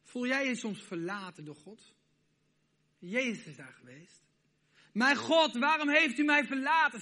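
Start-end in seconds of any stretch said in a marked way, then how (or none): 4.9-7.0 loud, high anguished cry; Jesus crying out on the cross, anguished and loud. Retold or imitated. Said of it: "schreeuwde hij uit".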